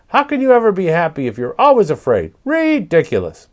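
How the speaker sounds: high, scornful parrot voice; fast, high-pitched bird chatter; clucking parrot. A narrator's reading. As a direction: scoff at them as a cheeky bird. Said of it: "clucked Papagayo".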